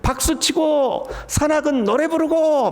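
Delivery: high, stretched-out preacher's cry; calling out. Acted. Preacher summoning sea and mountains to praise, calling out. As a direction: summon nature in a rising cry. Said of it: "소리 지르고".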